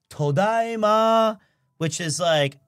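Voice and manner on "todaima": "Todaima" said slowly, syllable by syllable; Japanese greeting. Shown as a demonstration. Teaching the guest the greeting, slow and careful. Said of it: "You say".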